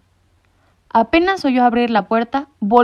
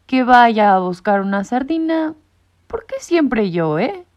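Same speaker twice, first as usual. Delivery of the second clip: slow, whiny errand-cat complaints; grumbling secretary; 5 semitones higher, 4 dB louder, slower than usual. A narrator's reading. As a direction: protest being sent again. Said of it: "protestó secretario". (gato secretario que protesta quejumbroso)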